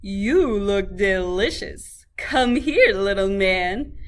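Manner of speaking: slow, loud, drawn-out cow voice; hungry cow eyeing the gingerbread man. A narrator's reading. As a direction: savor each word like a hungry beast. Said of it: "a very hungry cow".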